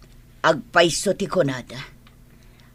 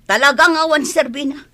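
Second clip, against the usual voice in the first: louder, higher angry burst; angry outburst; 6 semitones higher, 7 dB louder than usual. Acted. Daughter angrily condemning the worthless man. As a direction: spit out the contempt.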